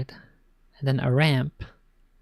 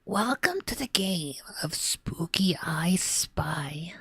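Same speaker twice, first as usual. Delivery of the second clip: a lighter, slow whispered ghoul; spooky skeleton voice; 3 semitones higher, 3 dB quieter, slower than usual. Shown as a demonstration. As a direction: ease off into a half spooky whisper. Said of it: "I don't think I can do that for too long".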